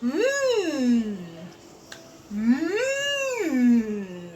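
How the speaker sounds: a contented mm as if tasting food; a mild, happy food hum; yummy mm. Acted. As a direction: savour it like something delicious. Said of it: "rubs its tummy and says".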